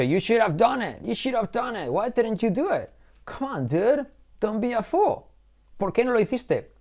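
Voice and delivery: mock scolding friend. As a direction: tease him for not doing it.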